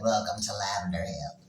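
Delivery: lightly voiced glamorous diva welcome. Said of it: "after Eartha Kitt in the movie Boomerang".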